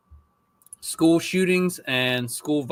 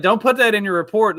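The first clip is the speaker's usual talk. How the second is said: higher, louder quote of himself warning; retold urgent warning; 7 semitones higher, 7 dB louder than usual. Dad warning his daughter about her school report, retold urgent warning. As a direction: warn with emphasis, as if retelling it.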